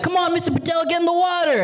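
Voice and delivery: put-on high-pitched excited kids begging; squeaky kid voices calling from the pool; kids' voices. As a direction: voice the little kids pleading.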